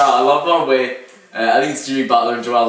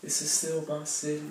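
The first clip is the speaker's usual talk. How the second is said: hushed player impression; 4 semitones higher, 12 dB quieter than usual. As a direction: say it softly, as if to yourself. Retelling a player's words after a shot.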